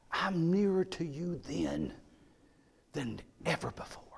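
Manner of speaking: a gentle voice of God, lightly voiced; tender divine promise. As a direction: speak God's comfort tenderly. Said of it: "And God said".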